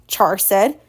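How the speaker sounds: ordinary delivery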